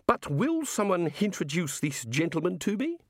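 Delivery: high, pointed request; prim insistence; restrained sharpness. Acted. Missus Whitlow demanding an introduction, politely sharp. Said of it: "in as sharp a tone".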